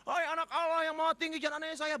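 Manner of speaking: a shrieking, pleading demon, high and loud; demon begging. Retold or imitated. The demon begs Jesus not to torment it, shrieking. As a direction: cry out for mercy, terrified. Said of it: "setannya teriak-teriak".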